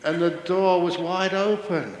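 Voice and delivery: ordinary talk, nothing marked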